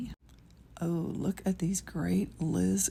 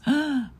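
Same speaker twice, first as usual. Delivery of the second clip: delighted gasp; 7 semitones higher, 5 dB louder than usual. Real, not acted. Spots sequins.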